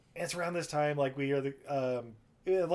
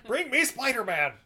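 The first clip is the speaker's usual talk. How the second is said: higher, louder, hammed-up demand; joking captain bellowing an order; mock shout; 10 semitones higher, 6 dB louder than usual.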